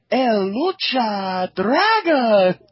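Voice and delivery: slow, high, loud drawn-out call; lucha libre announcer. Retold or imitated. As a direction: stretch it out like an arena announcer. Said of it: "be all like".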